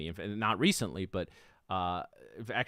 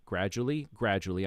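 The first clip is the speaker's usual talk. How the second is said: gradually, gradually, each one leaned on; stress on gradually. Shown as a demonstration. Host to viewers, stressing gradually. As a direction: press hard on gradually, word by word. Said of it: "I want to emphasize the word gradually".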